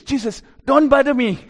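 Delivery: high, loud demon telling someone off; demon's voice. Acted. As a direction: shout it as an irritated demon. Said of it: "the voice of the demons".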